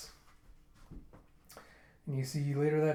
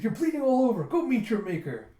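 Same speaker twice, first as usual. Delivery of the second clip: high, loud exasperated yell; mock angry scolding; 8 semitones higher, 7 dB louder than usual. Friend scolding bleeding flirt, mock fury. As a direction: bawl out the fool, playfully exaggerated.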